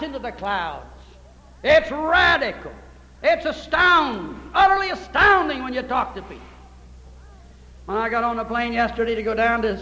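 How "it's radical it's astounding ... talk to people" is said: amazed preaching. Acted. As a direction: stretch each word in wonder. Preacher builds on the rapture idea.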